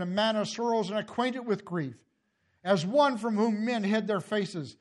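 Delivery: ordinary delivery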